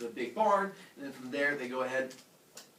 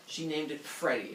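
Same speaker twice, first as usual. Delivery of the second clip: plain reading voice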